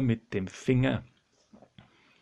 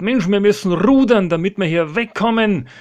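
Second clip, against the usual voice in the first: raised, pressing command; urgent order; 7 semitones higher, 5 dB louder than usual. Acted. Petrus urges the men to row out of the storm, pressing call. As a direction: push the crew to act. Petrus gibt im Sturm Kommandos.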